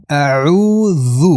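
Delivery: 'اعوذ' slow, with a long held oo; two-count elongation. Shown as a demonstration. Teacher models the word for students, slowly stretched. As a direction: draw out the middle of 'اعوذ'.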